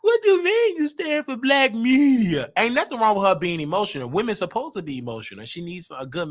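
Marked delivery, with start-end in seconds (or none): 0.0-2.5 higher, slow, incredulous mock voice; mocking Neek. Mocking Neek's high, incredulous reply to his post. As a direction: mimic her disbelief, pitched up. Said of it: "he gonna Neek".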